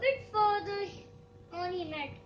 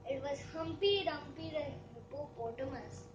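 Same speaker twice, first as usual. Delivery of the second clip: plain reading voice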